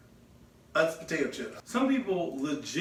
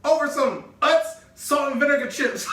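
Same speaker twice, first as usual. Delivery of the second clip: much higher and louder, exasperated; incredulous; 9 semitones higher, 6 dB louder than usual. Real, not acted. Answering his cohost about the chips, loud disbelief. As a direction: spit out the absurd reason.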